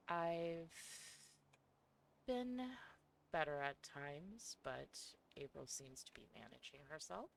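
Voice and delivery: soft, hesitant alter voice; quiet shy persona. Acted. Plays Coco, a second persona.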